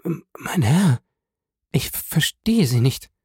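breathless, broken whisper; deathly pale wife whispering in fear; terrified whisper. A narrator's reading. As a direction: barely get the words out in terror. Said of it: "leichenblass erwiderte sie".

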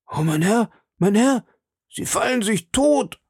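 terrified servant crying out to his master, high and panicked; frightened servant crying out. A narrator's reading. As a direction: beg him not to jump. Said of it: "schrie Blanchet".

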